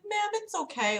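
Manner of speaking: high, soft reassurance; gently calm an upset older caller, pitched high; soothing. Retold or imitated. Acts out soothing the old lady.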